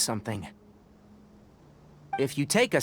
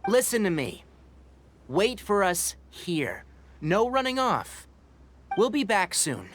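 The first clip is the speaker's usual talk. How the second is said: slow, firm instructions; 5 semitones higher than usual. Acted. Speaking slowly to the crab.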